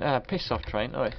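ordinary talk

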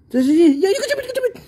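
high, loud, sudden alarm; alarmed cry. Real, not acted. Dad crying out in alarm as something gets pinched, high and loud.

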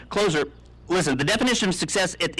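ordinary delivery

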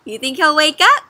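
ordinary delivery

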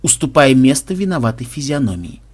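usual speaking voice

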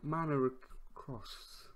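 ordinary delivery

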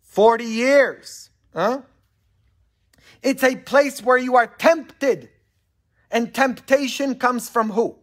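high, loud preacher's delivery; emphatic preaching. Acted. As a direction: drive it home to the congregation. Preacher at full force.

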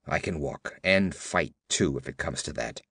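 terse and stubborn, pitched low; a lightly voiced stern old woman, low and grudging. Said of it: "grunted the old woman".

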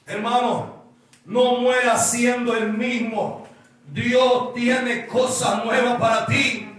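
loud, stretched pleas to the congregation; slow, loud sermon climax; fervent preaching. Acted. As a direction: urge them from the pulpit. Preaching at full force.

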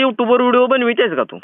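ordinary delivery

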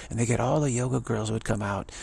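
usual speaking voice

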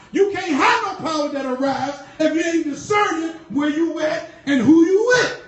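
loud, high, driving sermon voice; preaching at full force. Acted. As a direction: shouts it out to the congregation.

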